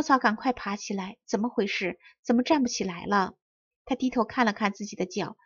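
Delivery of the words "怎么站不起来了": usual speaking voice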